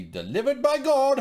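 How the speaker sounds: high, loud mock declaration; rising to a big theatrical finish; mock proclamation. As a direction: proclaim it like a hammy avenger. Mock-quoting a line about justice.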